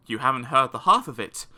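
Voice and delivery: usual speaking voice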